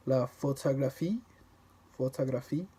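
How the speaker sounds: "la photographie" with every syllable equally weighted; unstressed French syllables. Shown as a demonstration. Showing how French avoids stressing syllables, evenly weighted. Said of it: "you don't stress any particular syllable".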